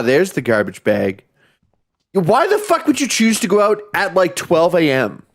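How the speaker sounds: normal talking voice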